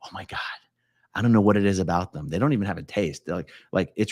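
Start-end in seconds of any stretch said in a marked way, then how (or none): 0.0-0.4 breathy whispered Oh my God; a hushed little gasp of delight; whispered aside. Real, not acted. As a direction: say it under your breath in awe. Overcome by love of mushrooms.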